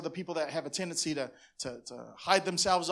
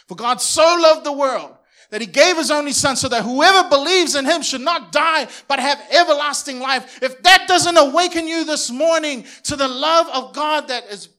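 loud, higher, impassioned sermon climax; full-force preaching; 9 semitones higher, 13 dB louder than usual. Real, not acted. Pastor urging the church to love neighbors, loud impassioned preaching. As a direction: preach it with all your fire.

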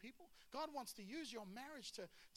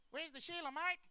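higher-pitched comic Aussie voice; Australian accent; 7 semitones higher, 6 dB louder than usual. Acted. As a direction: call it out as a searching Australian bloke. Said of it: "that's the only Australian accent".

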